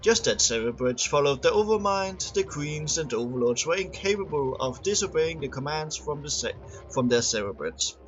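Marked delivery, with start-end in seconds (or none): none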